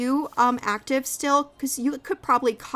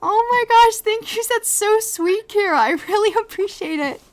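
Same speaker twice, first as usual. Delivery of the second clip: high, loud delighted gush; touched, gushing thanks; 8 semitones higher, 6 dB louder than usual. Real, not acted. Host thanking co-host for heartfelt praise, gushing. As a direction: gush with gratitude, a laugh breaking in.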